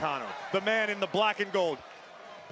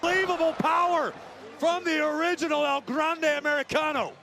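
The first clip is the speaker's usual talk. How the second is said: wrestling commentator; 6 semitones higher than usual. Acted. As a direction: call the big moves as they land. Live wrestling play-by-play.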